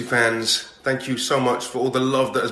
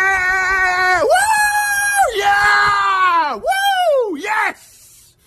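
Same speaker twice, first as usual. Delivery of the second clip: loud whooping yells; high, loud celebratory woos; wild cheering; 10 dB louder, much slower than usual. Real, not acted. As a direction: whoop and cheer at full volume. Cheering wildly on set.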